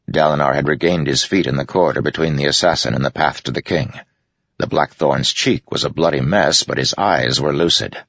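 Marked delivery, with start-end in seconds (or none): none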